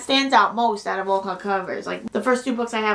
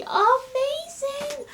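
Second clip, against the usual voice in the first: much higher, slow, squealing; excited squeal; much slower than usual. Real, not acted. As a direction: stretch it out in a thrilled squeal. Thrilled about final book.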